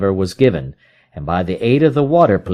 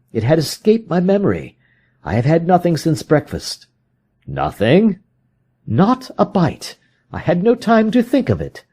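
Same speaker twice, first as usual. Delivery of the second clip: higher, worn detective voice; Holmes, weary and hungry; 8 semitones higher than usual. Acted. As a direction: brush off the hunger.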